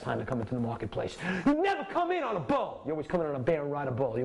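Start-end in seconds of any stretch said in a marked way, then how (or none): 1.4-2.8 high punchy warning; emphatic rule. Real, not acted. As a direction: drive the rule home.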